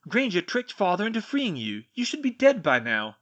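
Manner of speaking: loud, high indignant outburst; angry accusation. A narrator's reading. Draco to the elf who was freed, loud angry accusation.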